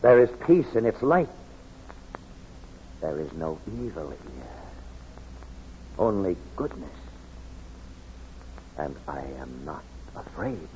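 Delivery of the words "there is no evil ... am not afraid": much softer, calm and reverent; a soldier in wonder under the star, hushed and reverent; hushed awe. Acted. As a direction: speak it softly, filled with peace. Awed under the holy light.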